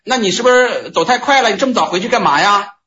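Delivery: high, teasing question; voicing the Patriarch. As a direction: pitch it up as the master holding him back.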